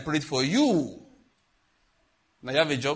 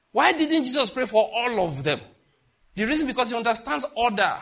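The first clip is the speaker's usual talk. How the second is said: preaching; 8 semitones higher, 5 dB louder than usual. Acted. Preaching at full force.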